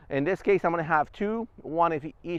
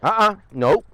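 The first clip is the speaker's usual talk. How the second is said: loud, higher no to the dog; firm correction; 4 semitones higher, 7 dB louder than usual. Real, not acted. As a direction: stop the dog firmly.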